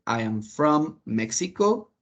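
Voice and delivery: slow, stretched model sentence; slow example answer. Shown as a demonstration. Teacher giving a sample answer to the class, slowly. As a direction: spell it out slowly so students follow. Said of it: "Yo podría decir".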